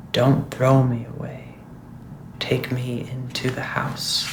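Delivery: soft, slow hollow skull voice. Acted. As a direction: speak hollow and slow from within. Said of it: "speak in a hollow voice".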